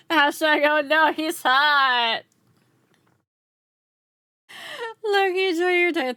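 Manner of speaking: talking through high, loud laughter; laughing. Real, not acted. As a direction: crack up while getting the words out. Laughing at chat's reaction.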